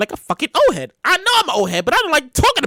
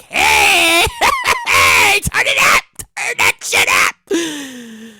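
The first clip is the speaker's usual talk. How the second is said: hype party shout; 6 semitones higher, much slower than usual. Pretend. Partygoer yelling at the DJ to crank it, hype shout. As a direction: shout for the DJ to turn it up. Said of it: "I wanna be in the spot like".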